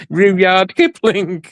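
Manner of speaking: who on earth is Rudyard Kipling, said slowly and high; incredulous. Retold or imitated. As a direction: drag out Rudyard Kipling in disbelief. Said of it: "I'm thinking, Who the bloody hell's".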